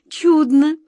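high, loud astonished exclaim; be amazed; surprised. Acted. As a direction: Katya amazed at the strange news. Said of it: "удивилась Катя".

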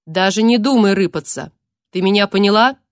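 unnatural distorted menacing voice; eldritch horror. Acted. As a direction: threaten in an inhuman distorted voice. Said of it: "неестественно низкий, искажённый голос".

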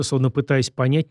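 ordinary delivery, nothing marked

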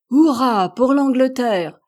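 slow, high, loud hurrah; cheering. A narrator's reading. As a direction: cheer it out together, drawn out. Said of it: "s'écrièrent les cinq hommes".

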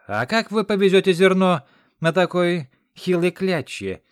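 high, loud shout after them; yelled question. A narrator's reading. Aide yelling suspiciously after the cart, loud. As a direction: holler it across the bridge.

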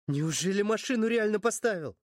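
ordinary delivery, nothing marked

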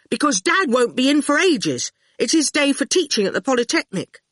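a hint of a nervous boy; young boy voice; lightly voiced boy. Boy Howard voiced in story.

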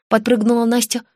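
usual speaking voice

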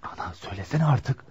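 quiet, impatient nudge; hushed urging. Acted. Son quietly urges his mother to ask for the girl, hushed. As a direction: press her quietly to get on with it.

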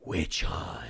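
voiceless whisper of "Witch hunt" in her ear. Acted. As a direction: breathe it close and cold. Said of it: "leans down ... and whispers".

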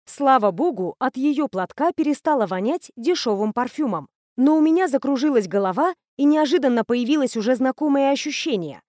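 ordinary delivery, nothing marked

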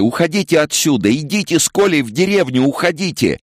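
high, urgent mother's cry; desperate high-pitched commands; screaming. A narrator's reading. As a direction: scream at them to flee. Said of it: "закричала Ася".

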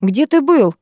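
mildly strict interrogation; stern. A narrator's reading. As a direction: demand an explanation strictly. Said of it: "строго спросила Лена Потапова".

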